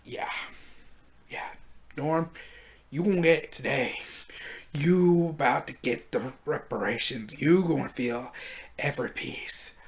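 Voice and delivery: quiet, low growled vengeance promise; a hero's softly spoken revenge vow; low menacing threat. Retold or imitated. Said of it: "my man's like".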